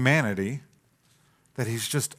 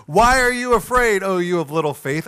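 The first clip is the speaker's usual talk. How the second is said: higher, louder, half-teasing Jesus; amused Jesus; 6 semitones higher, 6 dB louder than usual. Retold or imitated. Jesus teasing the terrified disciples, amused. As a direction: play Jesus lightly mocking his soaked disciples. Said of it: "I just imagine the comedy of this scene".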